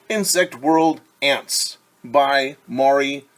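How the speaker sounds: slow, loud, deliberate title read; reading title aloud. Acted. Teacher reading book title to kids, slow and clear. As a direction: read the title carefully for little ones.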